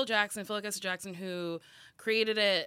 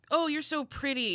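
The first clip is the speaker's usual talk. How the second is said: mock cooing over a child, higher; gushing adult; 7 semitones higher than usual. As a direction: lightly imitate fawning grown-ups.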